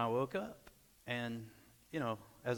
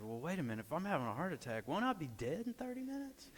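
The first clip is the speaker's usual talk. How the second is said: retold disbelief, climbing high at the end; incredulous question; 5 semitones higher, 5 dB quieter, faster than usual. Husband fearing heart attack questions wife's plan, incredulous. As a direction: start softly, rise into comic incredulity.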